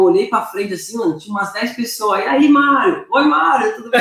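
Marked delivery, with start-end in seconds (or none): 2.2-4.0 higher-pitched mock fan hello; bright-pitched, calling imitation of well-wishers; imitated excited greetings. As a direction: call out like strangers recognizing someone. Strangers greeting him at airport.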